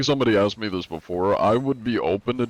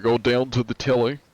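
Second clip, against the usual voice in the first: put-on half-successful British accent; 2 semitones higher than usual. Streamer struggling to show British, British accent. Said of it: "I can't do a British".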